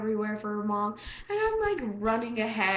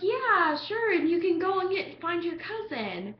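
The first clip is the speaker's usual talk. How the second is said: forced cheer; 8 semitones higher, 4 dB louder than usual. Retold or imitated. Trying to ditch guy.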